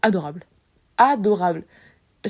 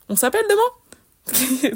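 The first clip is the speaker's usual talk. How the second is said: cashier's knowing question; 5 semitones higher, much slower than usual. Retold or imitated. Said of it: "elle a regardé ma grand-mère et elle a fait".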